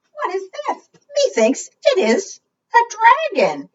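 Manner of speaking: high, wondering knight voice; a curious knight peering into the dark; high-pitched storybook knight asking what this is; puzzled knight. Acted. Knight hears a roar, puzzled.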